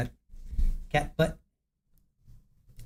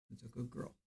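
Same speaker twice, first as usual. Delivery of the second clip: much softer, lower cooing; soft praise to cat; 5 semitones lower, 27 dB quieter, faster than usual. Real, not acted.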